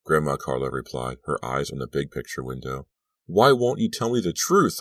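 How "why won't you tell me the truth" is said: higher, louder mock scream; mild scream. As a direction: shout the accusation. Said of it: "I screamed".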